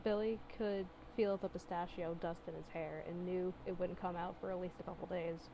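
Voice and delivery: ordinary delivery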